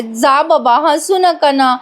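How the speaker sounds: imitating an irritated, whining wife; mildly annoyed. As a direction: sulk like a peeved wife. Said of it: "Nandini चिडून म्हणते".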